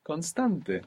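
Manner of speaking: constante said the Spanish way, kon-STAN-te; Spanish pronunciation. Shown as a demonstration. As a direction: pronounce constante with Spanish vowels.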